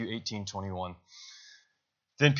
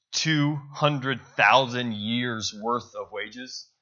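slow incredulous; 3 dB louder, much slower than usual. Retold or imitated. Master demanding impossible sum.

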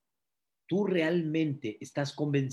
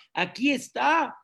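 preaching fervently; 8 semitones higher than usual. Acted. Pastor exhorts congregation.

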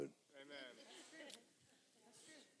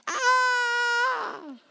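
startled cry; 4 dB louder than usual. Retold or imitated. Newcomers' startled reaction.

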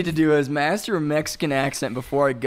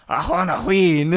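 bad Mexican accent; 3 semitones higher than usual. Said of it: "yours is even worse".

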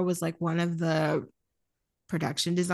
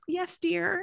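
meek doting voice; 10 semitones higher than usual. Retold or imitated. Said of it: "he's like".

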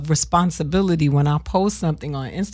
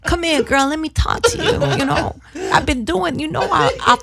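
high voice with a sexy lisp; smooth-talking lisp, laughing through it; Bob Sumner impression; 8 semitones higher than usual. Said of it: "He's like".

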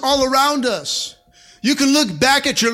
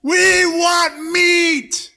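very slow, high, drawn-out mock wail; mock wailing demand; 8 semitones higher, 4 dB louder, much slower than usual. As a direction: moan it like a crowd of wailing Israelites. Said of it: "coming to the door and wailing".

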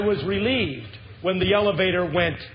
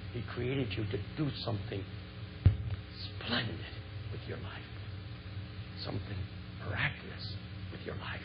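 much softer, near-whispered promise; hushed sincere appeal; 6 semitones lower, 10 dB quieter than usual. Real, not acted. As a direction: lean in and breathe it to them.

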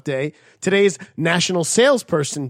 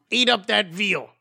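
slow, higher character voice; impression voice; 7 semitones higher than usual. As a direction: do a movie character line. Quoting a movie voice.

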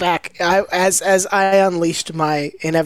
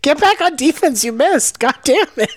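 higher-pitched mock heckling; irate fan; 6 semitones higher than usual. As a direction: scold the player like an annoyed spectator. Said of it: "People will be like".

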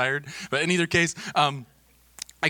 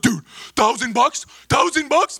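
high, loud mock astonishment; imitated amazed kids; 11 semitones higher, 6 dB louder than usual. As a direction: blurt it like a teen who can't believe the prize.

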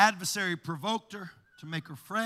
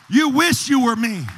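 loud rebuke; 4 semitones higher, 14 dB louder than usual. Acted. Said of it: "what you gotta do is just say".

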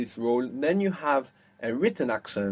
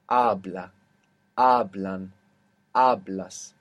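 "habla, hablan, hablas" each stressed on the first syllable, AH; low, separated demo words "habla, hablan, hablas", leaning on AH; penultimate stress; 4 semitones lower, slower than usual. Shown as a demonstration. Teacher listing verb forms for the rule, "habla, hablan, hablas" stressed on second last syllable. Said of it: "accent will be on the penultimate syllable".

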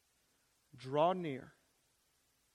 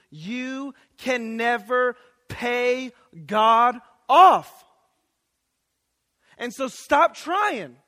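very slow and building louder to "off"; emphatic preaching; 2 semitones higher, 7 dB louder, much slower than usual. Acted. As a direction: lean on every word, then land it. Preacher pressing the climax.

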